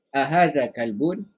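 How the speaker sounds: "Ahaza kalbun?" slow with a rise at the end; question tune. Shown as a demonstration. Teacher models a question for the student. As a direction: ask "Ahaza kalbun?" slowly, rising. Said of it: "kena ada intonasi pertanyaan".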